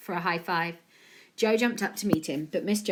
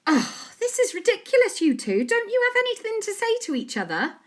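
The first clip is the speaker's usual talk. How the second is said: exasperated mum voice; 5 dB louder than usual. A narrator's reading. Mum scolding both boys.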